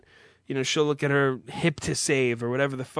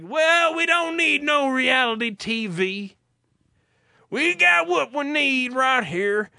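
mock Southern country drawl; 9 semitones higher, 3 dB louder, slower than usual. Mocking country song lyrics with a high, slow Southern twang. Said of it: "fucking southern country references".

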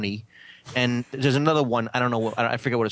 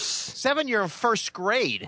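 incredulous; 9 semitones higher, much slower than usual. Real, not acted. Incredulous at kid age.

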